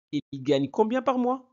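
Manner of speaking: high-pitched question, "combien par mois" held up high; questioning intonation. Shown as a demonstration. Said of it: "Avec intonation".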